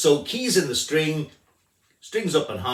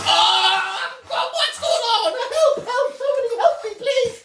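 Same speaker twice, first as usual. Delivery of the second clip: very high, loud panicked screams; screaming for help; 7 dB louder than usual. Acted. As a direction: shriek for help in mock terror. Mock terror to end the promo.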